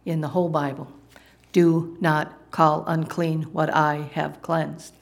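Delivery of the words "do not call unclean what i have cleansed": slow, measured divine pronouncement; voice of the Lord. Acted. The Lord's second word to Peter, slow pronouncement. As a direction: weigh out each word slowly and solemnly. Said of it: "here comes the second word from the Lord".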